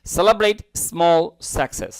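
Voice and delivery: slow, careful English read-aloud; teacher reading the sentence slowly; slow model reading. Shown as a demonstration. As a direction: spell out each English word slowly for learners.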